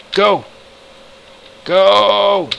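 normal talking voice

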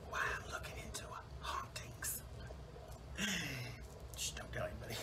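hushed, unvoiced confidential aside; secretive whisper. Telling the audience a secret plan about hauntings, secretive whisper.

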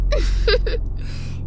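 breathy, high secretive little giggle; child's giggle. Acted. Girl dodging Shao's question with a giggle. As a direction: giggle as if keeping a dark secret.

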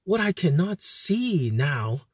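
usual speaking voice

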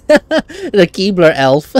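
ordinary talk

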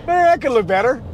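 high, unimpressed mock critic voice; unimpressed grumble. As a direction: voice the blurry camera grumbling. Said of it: "It looks a little like".

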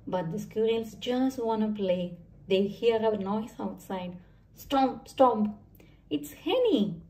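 usual speaking voice

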